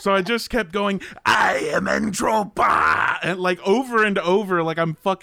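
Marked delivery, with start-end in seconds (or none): 1.2-3.2 loud, slow monster voice. Retold or imitated. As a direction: boom it out like a monster. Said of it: "you did a monster voice".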